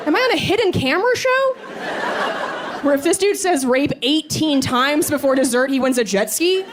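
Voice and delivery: mock outrage, voice shooting up in disbelief; shocked disbelief. Her shocked inner reaction.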